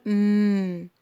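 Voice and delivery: م as a long held hum; sound of M. Shown as a demonstration. Teacher showing the Arabic meem sound, held hum. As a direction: sustain م as "mmm". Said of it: "ال ام بتنطق زي حرف".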